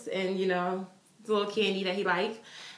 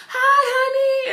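put-on much higher, slow, loud kid voice; imitating a little boy; 8 dB louder, slower than usual. Boy greeting the fan by name, high and sweet.

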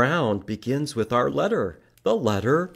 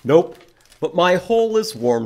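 louder, stubborn groundhog refusing; 6 semitones higher, 7 dB louder than usual. Acted. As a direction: insist cheerfully, a bit louder. Groundhog voiced in story.

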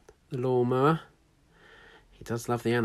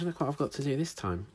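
ordinary delivery, nothing marked

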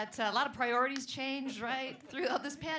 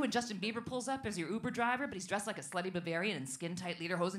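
usual speaking voice

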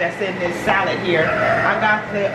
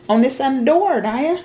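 calling out; 7 semitones higher, 4 dB louder than usual. Real, not acted. Calling to Naya across kitchen.

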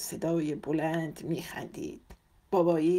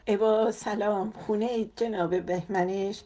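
higher-pitched, gushing coquettish greeting; coquettish surprise; 4 semitones higher than usual. A narrator's reading. Woman visitor finding the father at home, coquettish surprise. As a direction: put on a sugary surprised hello.

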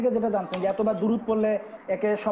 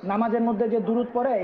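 ordinary delivery

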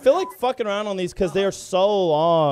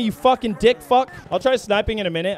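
ordinary delivery

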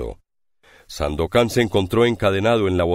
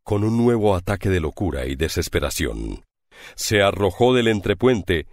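plain reading voice

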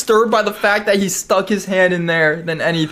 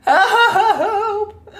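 very high, wavering wail; panicked cry for help; 12 semitones higher than usual. Real, not acted. Player frightened for the game character, panicked wail.

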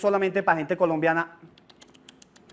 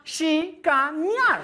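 "chicanear" said slowly, a bit higher; slow show-off demo; 5 semitones higher, much slower than usual. Shown as a demonstration. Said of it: "clases de fonética para gringos". ("chicanear" lento y presumido)

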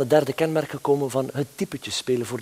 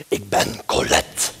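slow, breathy put-on voice with a laugh; funny character voice; 8 semitones lower, slower than usual. Comedian introducing his character, slow laughing put-on voice. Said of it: "een grappig stemmetje".

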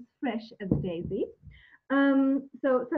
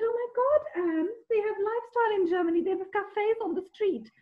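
much higher, fast, delighted gushing; imitating an excited Australian friend, much higher and fast; amazed gush; 9 semitones higher than usual. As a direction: gush like a friend who just discovered something wonderful. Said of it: "came back and said".

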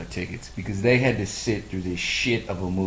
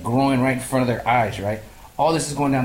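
usual speaking voice

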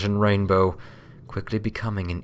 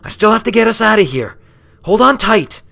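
high, loud, fast and eager; determined Rainbow Dash; 13 semitones higher, 11 dB louder, faster than usual. A narrator's reading. Rainbow Dash before the flight, fast loud determined call. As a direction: charge ahead, all business.

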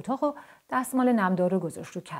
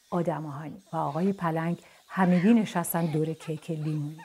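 ordinary delivery

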